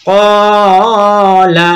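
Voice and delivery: "কলা" very slow, with "ক" held long; drawn-out first syllable. Shown as a demonstration. Teacher corrects for learners, only "ক" drawn out in "কলা". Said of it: "শুধুমাত্র কফ টেনে পড়বো".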